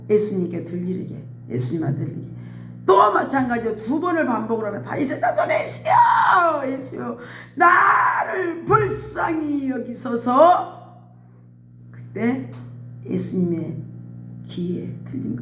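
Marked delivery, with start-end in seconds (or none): 4.8-10.8 high, slow wailing cry; crying shout. Retold or imitated. Blind beggar crying out to Jesus, high wailing shout. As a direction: wail it with all you have.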